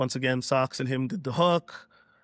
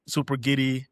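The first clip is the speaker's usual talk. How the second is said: usual speaking voice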